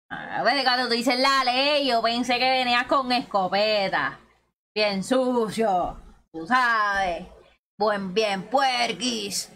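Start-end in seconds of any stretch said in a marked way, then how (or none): 4.8-9.5 lazy slow mock-dirty drawl; slow teasing drawl. Streamer teasing her squad, slow playful drawl. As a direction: stretch each word out slowly, playful. Joking about a dirty shotgun play.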